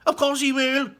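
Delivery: high, loud shriek. Acted. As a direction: shriek it at him. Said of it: "shrieked Missus Gloop".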